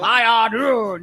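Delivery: trying out the show voice; slow DJ voice. Shown as a demonstration. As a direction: lean into each word like a radio host. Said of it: "that's what I'm gonna sound like".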